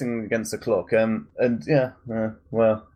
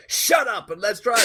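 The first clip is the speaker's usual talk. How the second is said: higher put-on voice as Axel; gruff driver voice; 12 semitones higher than usual. Axel telling the passenger to shut up, in a put-on voice. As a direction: snap it like the cocky driver.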